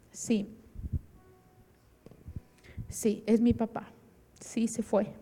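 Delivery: soft, halting, grave answer; subdued confirmation. Retold or imitated. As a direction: break terrible news quietly. Brother confirms father's death.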